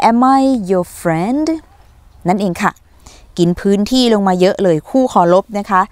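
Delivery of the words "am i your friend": slow model sentence. Shown as a demonstration. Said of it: "ถามคนอื่นว่าตัวฉันเป็นหรือเปล่า?".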